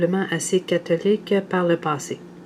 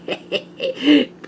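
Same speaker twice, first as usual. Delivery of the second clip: bright laugh; 8 semitones higher, 5 dB louder than usual. Real, not acted. Laughs at the reading.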